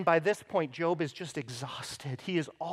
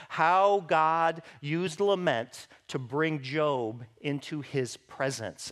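ordinary talk

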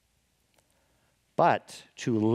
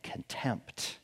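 whisper; 4 semitones lower, 11 dB quieter than usual. Acted. Dramatic whispered word in sermon.

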